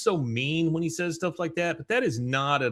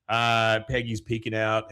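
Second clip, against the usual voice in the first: ordinary delivery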